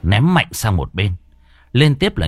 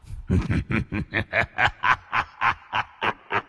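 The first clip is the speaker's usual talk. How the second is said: evil laugh; 3 semitones higher, 6 dB quieter than usual. Acted. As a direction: laugh over a trapped victim. Villain laughs after threat.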